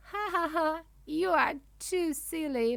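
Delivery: lightly acted mocking laughter; mock laughing. A narrator's reading. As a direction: tease with a read-out laugh. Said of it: "Dragon turns her head and laughs".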